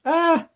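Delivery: short piercing high scream. A narrator's reading. A woman's terrified shriek at the blades, high and sharp. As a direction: Jane shrieks in terror at the blades. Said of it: "Jane screamed".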